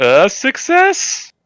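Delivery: slow, high, triumphant; drawn-out excited announcement. Real, not acted. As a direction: stretch it out in triumph.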